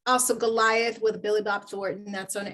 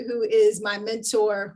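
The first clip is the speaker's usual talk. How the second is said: ordinary delivery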